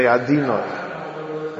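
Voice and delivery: slow sung-out "Adinoi", overly frum; hammed-up sanctimony; mock pious. Shown as a demonstration. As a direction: chant it like an over-pious worshipper. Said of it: "very frum".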